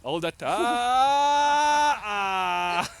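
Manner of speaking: high loud urging shout. Real, not acted. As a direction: yell at them to hold. Coach shouting during hold.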